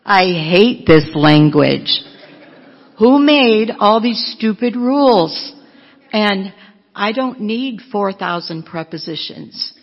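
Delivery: loud, slow exasperated outburst; frustrated learner ranting loudly at grammar; mock frustrated rant. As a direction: vent at the language, loud and slow. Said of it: "what do you write".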